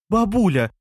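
loud, high exclamation of protest; exclaiming. A narrator's reading. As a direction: cry out to call grandma to order. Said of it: "воскликнула Елена".

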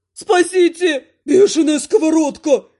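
loud, panicky servant's yell; wild cries for help. A narrator's reading. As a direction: shout in comic panic, pitch jumping up. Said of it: "дикими воплями".

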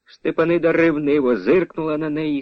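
ordinary delivery, nothing marked

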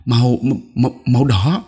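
put-on a scared child stammering an answer; nervous stammer. A narrator's reading. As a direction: trip over the first sound before getting it out. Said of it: "tôi đáp".

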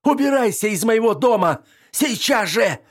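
high, loud screaming order; screaming, voice cracking. Acted. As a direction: scream until the voice breaks. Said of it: "закричала она так громко, что сорвала голос".

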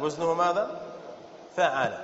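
ordinary talk, nothing marked

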